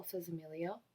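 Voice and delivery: ordinary delivery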